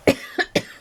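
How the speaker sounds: dry cough from swallowed dust. Real, not acted. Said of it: "I think I just swallowed some dust".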